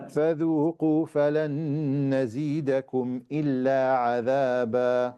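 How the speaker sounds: measured, melodic Quranic chanting; Quran recitation. Acted. Teacher recites the verses to the class before explaining.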